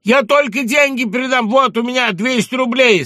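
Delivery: loud pleading. Acted. Pleading with gendarmes.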